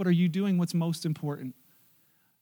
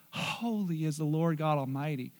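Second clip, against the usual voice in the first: soft, low, awed proclamation; quiet awe, much softer; hushed reverence; 4 semitones lower, 9 dB quieter than usual. Shown as a demonstration. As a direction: let it fall to a reverent hush. Drops to hushed reverence after shout.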